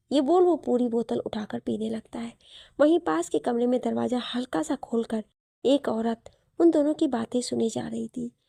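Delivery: usual speaking voice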